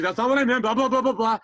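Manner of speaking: high, loud panicked backpedalling; flustered dad. Retold or imitated. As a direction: imitate Dad gabbling to take it back. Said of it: "he just got flustered".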